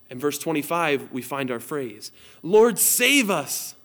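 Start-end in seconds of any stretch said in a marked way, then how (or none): 2.4-3.7 higher, louder, slow pleading read; fervent scripture plea. Acted. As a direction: cry out the verse to God. Reads the key phrase.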